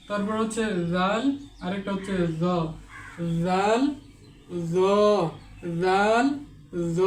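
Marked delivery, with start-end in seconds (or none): 3.4-7.0 teacher contrasts জাল and জ; letter contrast demo. Shown as a demonstration. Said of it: "এভাবে উচ্চারণ করতে হবে". (জাল ও জ পার্থক্য)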